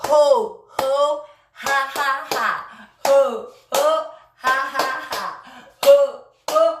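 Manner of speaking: loud, high rhythmic ho-ho ha-ha-ha; laughter exercise chant. Shown as a demonstration. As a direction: belt out forced laughs on the beat. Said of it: "rentrons dans les exercices de rire".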